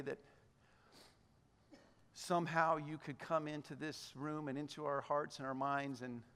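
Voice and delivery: soft, low-pitched, earnest prayer. Real, not acted. Pastor praying with his congregation before the passage, prayerful. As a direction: pray quietly and sincerely to God.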